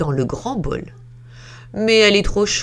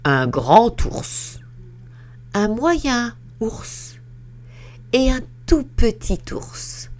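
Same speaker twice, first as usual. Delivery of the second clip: ordinary delivery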